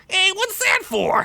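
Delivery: high, indignant squeak; peeved high goblin voice; annoyed goblin. Acted. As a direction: goblin startled and miffed. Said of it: "Skunk said, annoyed".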